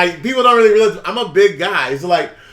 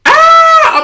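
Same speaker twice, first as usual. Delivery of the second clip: sudden high mock shriek, much louder; retold scream; 9 dB louder than usual. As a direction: let out a short imitated scream. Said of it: "she's like".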